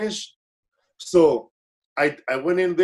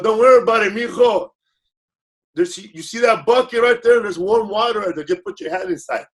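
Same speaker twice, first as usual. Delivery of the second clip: imitating the manager; 6 semitones higher, 5 dB louder than usual. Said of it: "He goes".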